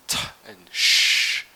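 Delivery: T and Sh hissed loud without voice; unvoiced sounds. Shown as a demonstration. Teacher shows the unvoiced sounds, hissed loud. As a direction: spit out T and Sh. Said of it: "but with the unvoiced sounds".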